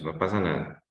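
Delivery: ordinary delivery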